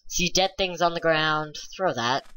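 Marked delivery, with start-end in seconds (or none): none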